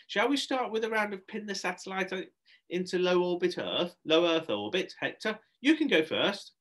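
high party-host voice; Shelley hosting. Acted. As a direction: announce the next game to children.

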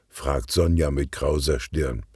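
plain reading voice

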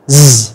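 "z" as a low, steady buzz; voiced Z. Shown as a demonstration. Teacher showing the vibrating sound, voiced Z. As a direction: buzz "z" with vibrating vocal cords. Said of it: "âm giờ ha các bạn".

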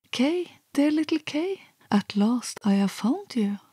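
high, ringing call of joy. A narrator's reading. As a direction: call out to him, high and glad. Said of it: "and called out".

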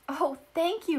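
bright, high, happy outburst of thanks; excited cry. A narrator's reading. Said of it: "cried Mary".

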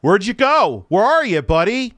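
loud, high taunting call to someone gone; taunting yell. Real, not acted. Streamer yelling at a guest who left, taunting. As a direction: call him out, jeering and loud.

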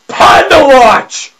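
loud, drawn-out hype yell; hyped shout. Real, not acted. Gamer shouting at the panda on screen, hyped yell. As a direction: announce it like a stadium call.

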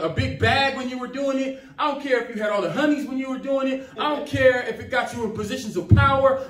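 ordinary talk

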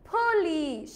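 drawn-out পুলিশ as phu-LEES; airy p and long "ee" in পুলিশ; correct English pronunciation. Shown as a demonstration. Teacher reveals the proper way, পুলিশ as stretched phu-LEES. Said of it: "তাহলে কি বলবো?".

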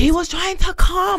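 squeaky mocking repeat; high mocking voice. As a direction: pitch it way up to mock him. Mocking the guy's line.

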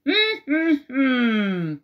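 sing-song sniffing hums from a wolf; wolf humming. A narrator's reading. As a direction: hum like a prowling storybook wolf.

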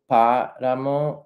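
páramo slow, stress on PÁ, first syllable; Spanish stress. Shown as a demonstration. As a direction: say páramo slowly with the weight on PÁ. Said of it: "it's the emphasis on the first syllable".

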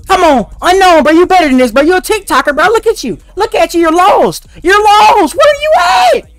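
high, loud mock scolding; loud teasing. Real, not acted. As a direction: roast the player loudly.

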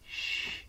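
a breathy unvoiced shh; quiet warning hiss at the door; hushing shh. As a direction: hush the others, softly. Listening outside the library.

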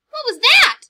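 very high, loud startled chirp; baby bird Flap crying out in alarm. Acted. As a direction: blurt it in a panic. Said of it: "cried Flap".

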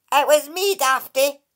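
the Dracai teasing Kate from the dub; cheeky water fairy. Acted. Cheeky sprite, teasing. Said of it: "said the voice".

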